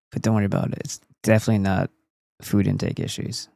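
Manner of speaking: ordinary delivery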